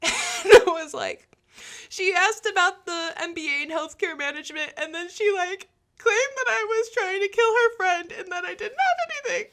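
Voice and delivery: high, laughing panic through the words; nervous laughing. Retold or imitated. Associate explaining the caller to Jamie, laughing nervously. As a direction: laugh in disbelief while explaining.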